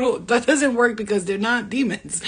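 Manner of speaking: usual speaking voice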